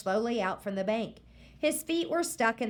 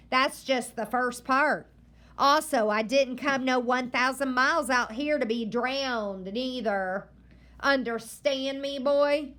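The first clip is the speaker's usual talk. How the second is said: stern grandma; 4 semitones higher, 5 dB louder than usual. Acted. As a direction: warn the boy firmly. Grandma lecturing the boy.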